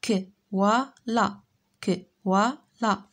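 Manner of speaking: كوالا broken into separate beats with gaps; syllable by syllable. Shown as a demonstration. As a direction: clap out كوالا one syllable at a time. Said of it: "Frappe les syllabes dans tes mains".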